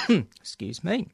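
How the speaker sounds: slow strained after a cough; recovering from a cough. Real, not acted. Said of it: "I'm not choking on the dust".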